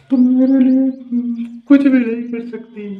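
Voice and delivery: mock sobbing. Friend whining to his girlfriend on the phone, mock tearful high voice. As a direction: ham up a heartbroken boy's tearful complaint. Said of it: "धीरे, धीरे भाई साहब रोने लगे".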